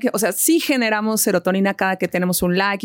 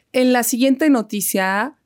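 mock news anchor; 3 semitones lower than usual. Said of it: "eso voy a hacer ahora".

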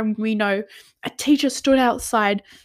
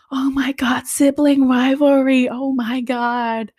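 higher-pitched gossipy gasping imitation; mock excited crowd; 5 semitones higher, 4 dB louder than usual. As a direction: imitate onlookers thrilled by scandal. Imitates excited crowd taking photos.

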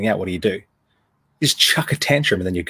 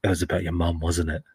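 mock whisper; 4 semitones lower, 5 dB quieter than usual. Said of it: "whispers in his ear".

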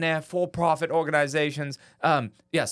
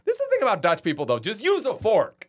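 mock rant; 7 semitones higher than usual.

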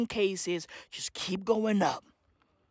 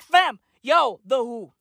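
normal talking voice

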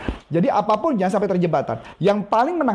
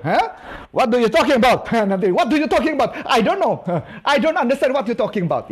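mock confused foreigner; 2 semitones higher than usual. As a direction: play a baffled foreigner, half laughing. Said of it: "Kalau luar negeri kan nggak mungkin".

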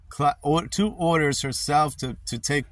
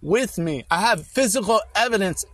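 real, higher-pitched urgent pleading; impassioned protest; 4 semitones higher than usual. Real, not acted. Father pleads with caseworker over his daughter, impassioned protest. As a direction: plead your innocence insistently, hammering key words.

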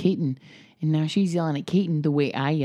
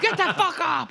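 retold yell at daughter; 12 semitones higher than usual. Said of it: "I was like".

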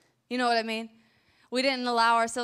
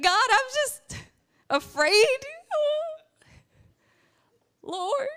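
high, loud whiny mock fear; mock fearful whimper; 13 semitones higher, 4 dB louder, slower than usual. Preacher plays herself whining in fear to God. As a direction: whimper like a scared child. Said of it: "I was like".

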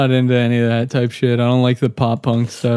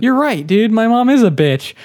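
sarcastic; 9 semitones higher than usual. Pretend. Sarcastic mock agreement.

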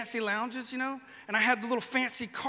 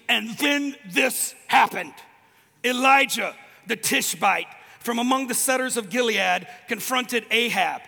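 loud, slow, proclaiming reading; dramatic scripture reading; 5 dB louder than usual. Acted. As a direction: declare the verse like a herald. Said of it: "I'm gonna read it to you".

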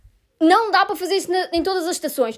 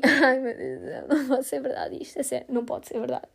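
softer and softer, despairing groan; despairing moan; 2 semitones lower, 7 dB quieter than usual. Retold or imitated. Retelling her despair at the missed bus, fading moan. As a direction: let it sink into hopeless quiet.

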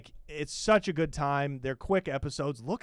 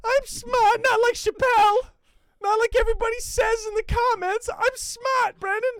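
whiny Malik impression; 6 dB louder than usual. Mocking Malik demanding respect from Brandon, very high and loud. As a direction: squeal it like a pouty little brother. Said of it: "he's the Fredo Corleone".

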